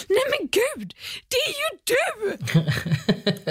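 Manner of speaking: high, gasping shocked squeal, slow on the last words; shocked neighbour. Retold or imitated. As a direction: squeal it out in disbelief, laughing. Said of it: "jag bara börjar asgarva och han blir så chockad".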